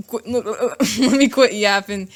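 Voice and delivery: slowed, laughing through a stumble; amused, breaking into a laugh at yourself. Real, not acted. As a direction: trip over the word and laugh.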